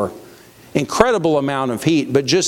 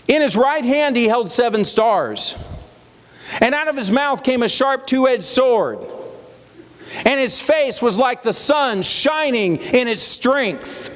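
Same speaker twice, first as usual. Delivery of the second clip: high, ringing Bible reading; proclaimed scripture; 8 semitones higher than usual. A narrator's reading. Preacher reading the climax of John's vision, proclaiming. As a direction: proclaim each image with raised pitch, pausing between clauses. Reads scripture aloud to congregation.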